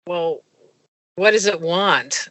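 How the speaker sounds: drowsy and muffled; mumbled into a pillow; sleepy. Retold or imitated. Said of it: "I have my face buried in a pillow".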